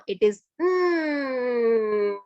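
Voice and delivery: "mm" hummed long and slow; pure m sound. Shown as a demonstration. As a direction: hold "mm" closed lips, no vowel. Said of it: "the sound is not, uh, na".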